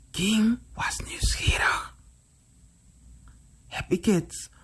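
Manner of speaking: hushed, secretive whisper; whispered storytelling. A narrator's reading. Storyteller lets the children in on King's curiosity, whispered. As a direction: whisper it to build suspense.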